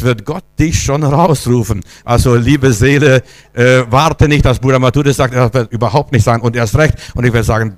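ordinary talk

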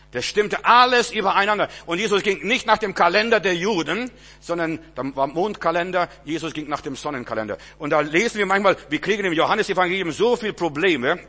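loud, high preacher's proclamation; fervent sermon shout; full-force preaching. Acted. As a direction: drive it home to the congregation.

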